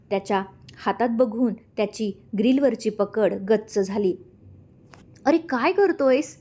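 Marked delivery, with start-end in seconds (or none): 5.2-6.4 high, slowed scolding cry; scolding shout. A narrator's reading. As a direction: call out to stop him, alarmed. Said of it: "Rashmi ओरडली".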